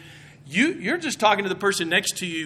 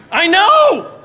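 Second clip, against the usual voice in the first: much higher, louder blurted exclamation; principal's exclamation; 11 semitones higher, 10 dB louder than usual. Retold or imitated. Principal blurting to the coaches over the intercom, loudly. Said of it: "the principal's like".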